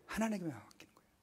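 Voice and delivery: ordinary delivery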